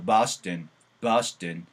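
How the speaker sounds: Boston said as "bastn", open a and swallowed o; bastn sound. Shown as a demonstration. Teacher drilling Boston with a swallowed ending. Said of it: "보스턴보다는 버시".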